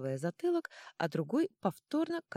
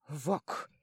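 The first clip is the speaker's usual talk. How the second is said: usual speaking voice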